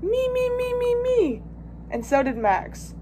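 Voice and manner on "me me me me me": very high, sustained string of cries; high-pitched volunteering cry; eager shout. A narrator's reading. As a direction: squeal it out like a child begging to be picked. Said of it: "Froggy shouted".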